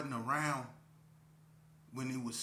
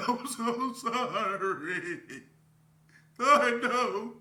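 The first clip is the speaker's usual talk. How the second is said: high, loud laugh through the words; cracking up while apologising; laughing apology; 10 semitones higher, 10 dB louder, much slower than usual. Real, not acted. Telling the guys on the phone sorry, laughing hard.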